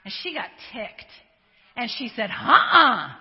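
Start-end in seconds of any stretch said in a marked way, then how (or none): 2.3-3.1 defiant refusal. Retold or imitated. Actress refusing the magazine.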